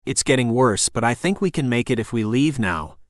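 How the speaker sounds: usual speaking voice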